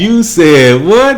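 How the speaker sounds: slow, high disbelief; incredulous. Retold or imitated. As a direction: stretch it out in shock. Teasing echo of the catchphrase.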